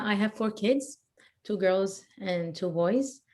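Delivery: ordinary talk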